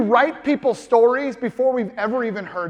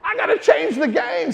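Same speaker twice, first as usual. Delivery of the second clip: much higher, slow, shouted mock panic; hammed-up shock at learning the shower rule; mock alarm; 9 semitones higher than usual. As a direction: squeal it like a sudden convert to science. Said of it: "And I was like".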